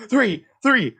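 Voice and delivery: high, loud frustrated shouting; yelling answer. Real, not acted. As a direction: yell it at the screen in exasperation.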